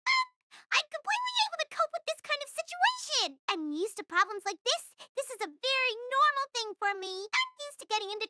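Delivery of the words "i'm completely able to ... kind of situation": put-on high-pitched childish bluster; defiant insistence. Lost schoolgirl refusing help, defiantly insistent.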